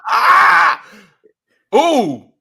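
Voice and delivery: loud high surprised cry; loud high exclamation; excited exclaim. Real, not acted. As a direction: react like you're thrilled. Reacting to song choice.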